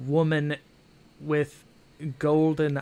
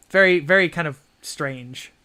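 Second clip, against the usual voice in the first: normal talking voice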